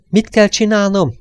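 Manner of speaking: loud and high, hammering KELL; shouted question. A narrator's reading. Störmer yells into the fainting man's ear, loud and urgent. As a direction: yell it right into his ear. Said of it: "ordította Störmer a fülébe".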